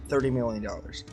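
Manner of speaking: ordinary delivery, nothing marked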